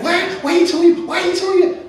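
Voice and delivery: high, loud, exaggerated teen voice; mimicking a teen's loud chatter on the train, loud and high; mocking a teen on the subway. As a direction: squeal it like a nosy kid. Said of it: "Isaac talked just like that".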